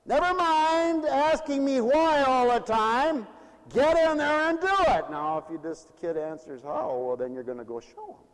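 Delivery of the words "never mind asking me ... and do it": higher, louder stern order; scolding parent. Retold or imitated. Preacher voices a parent ordering a questioning child. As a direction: bark it like an exasperated parent.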